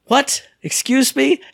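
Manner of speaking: high-pitched, disbelieving double take; incredulous reaction. Retold or imitated. Gamer hearing the vendor's odd word, high incredulous squeak. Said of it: "It made me laugh so hard".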